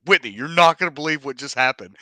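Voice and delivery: loud, fast, breathless news; excited. Retold or imitated. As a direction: rush it out, bursting to tell. Rushing to tell Whitney.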